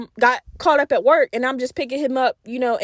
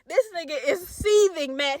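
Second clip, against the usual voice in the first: ordinary delivery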